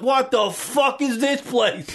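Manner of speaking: imitating a blown-away cat; amazed cat. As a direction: drag it out like an overwhelmed cat. Cats amazed by the outdoors.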